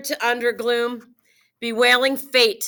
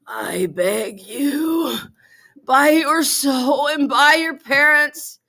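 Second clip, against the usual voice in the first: dying voice; 3 semitones higher than usual. Acted. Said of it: "Now I'm gonna do my dying voice".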